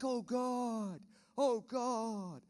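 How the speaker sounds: mock panic. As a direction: ham up the flustered believers. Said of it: "like, they panic".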